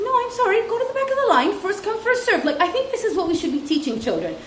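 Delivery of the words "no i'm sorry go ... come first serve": high, firm insistence; firm teacher. Retold or imitated. Teacher to pushy girl, firm insistence. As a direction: insist politely but firmly. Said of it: "I said".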